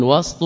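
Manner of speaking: وسط as "wast", ending "wastun"; وسط with a vowelless seen; silent middle consonant. Shown as a demonstration. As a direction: say وسط with s running straight into t, then "un". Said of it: "بالسكون".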